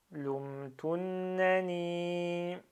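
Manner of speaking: "lumtunnani" very slow, held double n and long final i; shadda and prolongation. Shown as a demonstration. Teacher demonstrates noun with shadda, "lumtunnani" drawn out. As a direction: hold the nn of "lumtunnani", then stretch the last i. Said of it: "on fait le prolongement".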